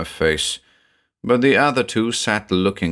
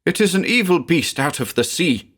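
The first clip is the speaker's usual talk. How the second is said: loud and woeful; 9 semitones higher than usual. A narrator's reading. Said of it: "in a loud and woeful voice".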